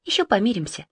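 soft inner thought; said as a quiet thought; thinking to herself. Acted. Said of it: "подумала Марина".

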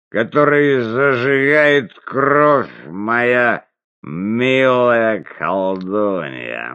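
пьяный голос, тянет слова медленно; выше и медленно, как пьяный; town drunk. Acted. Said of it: "Он пьяно засмеялся и икнул". (пьяный хозяин требует раздеть пленницу, пьяная речь)

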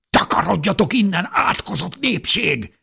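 high, loud cursing yell; angry shout. A narrator's reading. Defender curses the mob from his family, angry shout. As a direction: shout them off, stretching the end. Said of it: "kiáltotta nekik".

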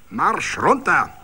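high, loud, slow commands; barked countdown. A narrator's reading. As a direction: count it like a drill sergeant. SA leader counts down.

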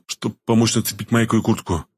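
plain reading voice

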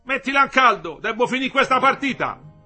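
high, loud offhand shout with a laugh; loud brush-off. A narrator's reading. Husband shouts back from his card game. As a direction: yell it over the card game. Husband yelling across street.

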